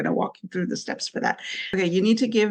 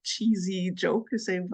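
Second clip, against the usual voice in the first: ordinary talk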